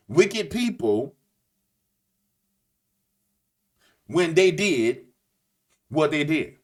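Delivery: halting oratory with long pauses between phrases; imitating a politician's speech. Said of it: "this is how he talked".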